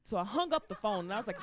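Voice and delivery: normal talking voice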